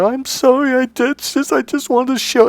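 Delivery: very high tearful apology; acted whimpering falsetto; imitating crying. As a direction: mimic a weeping girlfriend. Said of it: "she's crying 'cause I yelled at her".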